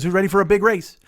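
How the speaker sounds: high-pitched pep-rally call; rally them like an eager race fan; enthusiastic hype. Retold or imitated. Stranger pumps up two racers, enthusiastic. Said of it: "He's all enthusiastic and inspired".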